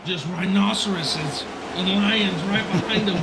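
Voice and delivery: loud, driving race call; live play-by-play. Real, not acted. Announcer on rivals behind Barroso, urgent play-by-play. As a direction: punch out the danger behind the leader.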